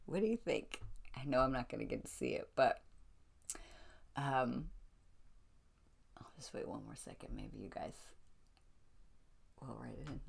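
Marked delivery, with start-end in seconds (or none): 6.2-10.2 hushed, secretive whisper; a soft whisper, waiting on the audience's answer. Real, not acted.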